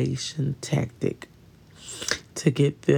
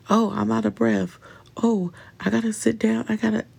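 higher, hammed-up fake exhaustion; mock out-of-breath whine; 7 semitones higher, faster than usual. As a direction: play a dramatic complainer needing to sit. Mocking a hypochondriac's breathlessness.

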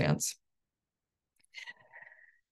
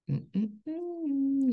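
disapproving hum; 9 semitones higher than usual. Acted. Coach disapproves of wrong thinking.

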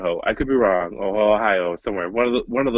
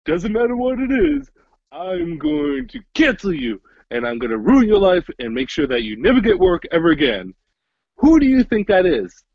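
mock cancel-mob voice; 8 semitones higher than usual. Retelling Dave's bit, high sneering cancel-mob impression. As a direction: play the menacing crowd that cancels. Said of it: "Let me do an impression".

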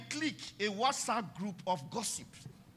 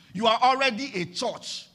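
louder repeat; 7 dB louder than usual. Shown as a demonstration. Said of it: "Do you understand that now?".

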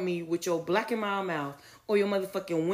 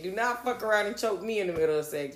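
ordinary talk

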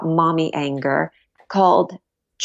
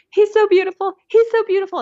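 much higher, gushing imitation of the awed husband; imitating husband in awe; 3 dB louder, faster than usual. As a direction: mimic the husband cooing over the newborn, pitched way up. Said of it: "you hear my husband's voice go".

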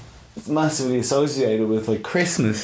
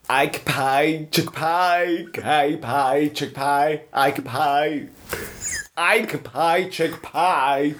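lightly mimicked Nell babble, higher pitched; Nell impression; 7 semitones higher, much slower than usual. Recital of Nell's made-up words for Andy, higher pitched. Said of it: "my incredible recital of Nell".

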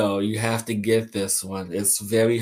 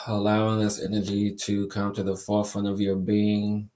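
guided meditation. Acted. Leads a crystal energy meditation.

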